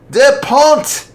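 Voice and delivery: high, loud startled cry; shocked outburst. Acted. Father choking on whiskey in shock. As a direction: blurt the name in shock. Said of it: "Fife spat out his whiskey".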